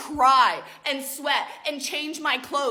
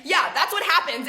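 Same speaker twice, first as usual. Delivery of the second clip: ordinary talk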